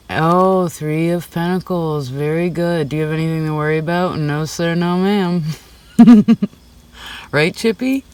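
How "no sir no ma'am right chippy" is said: higher playful tease breaking into a loud laugh; real laugh, then a high coo to Chippy; laughing at the pet. Real, not acted. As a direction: giggle while teasing the little animal.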